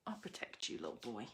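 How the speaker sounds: breathy whispered coo to a cute card; tender whisper. Real, not acted. YouTuber coos to the little octopus on her card, whispering. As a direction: hush it like soothing a tiny creature.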